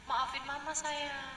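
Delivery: soft, tender apology; soothing mother. Retold or imitated. Mother bending down to soothe her sulking child, softly. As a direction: coax the sulking child gently. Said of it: "Kamu yang akan tunduk".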